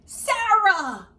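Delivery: high, loud call of her name; joyful exclaim. A narrator's reading. Narrator exclaiming as the turtle sees Sarah. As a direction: announce her with a burst of joy. Turtle spots his owner.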